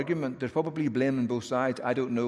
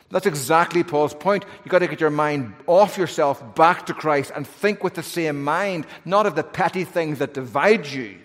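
loud, high impassioned preaching; preaching at full force; 4 semitones higher, 6 dB louder than usual. Acted. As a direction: build to a fiery sermon peak. Preaching climax again.

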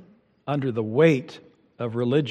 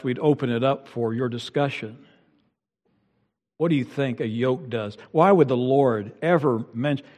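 ordinary talk

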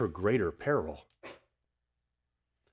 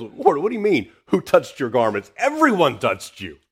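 loud, high, incredulous protest; incredulous disciples; 5 semitones higher, 9 dB louder than usual. Retold or imitated. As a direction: protest as if the question is absurd.

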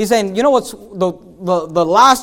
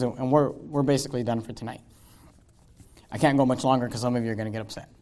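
quiet joking aside; 10 semitones lower, 10 dB quieter than usual. Real, not acted.

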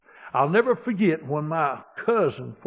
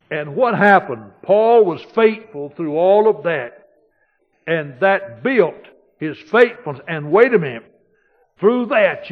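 louder, driving preacher's cadence; preaching at full force; 5 semitones higher, 13 dB louder than usual. Acted. Pastor driving home his point to the church, full-force preaching. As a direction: preach it hard to the congregation.

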